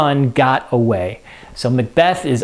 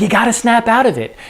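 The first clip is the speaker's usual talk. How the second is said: urging him; 7 semitones higher than usual. Acted. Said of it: "So she's like".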